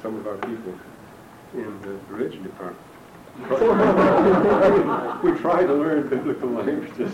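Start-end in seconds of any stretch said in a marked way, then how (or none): 2.9-5.2 loud real laugh, higher; big burst of laughter; hearty laugh at his own joke. Real, not acted. Laughs at religion department jab. 5.2-7.1 words bubbling with laughter; amused, laugh still in the voice. Real, not acted. Still laughing after joke.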